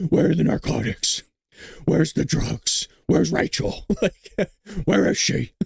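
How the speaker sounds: a mock Batman interrogation; laughing through a hammed-up Batman. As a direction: demand it like a caped hero.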